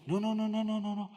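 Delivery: very high-pitched string of noes; high, rattled-off protest; mock emphatic denial. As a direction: imitate a wife brushing the question away. Acted wife hastily denying offence.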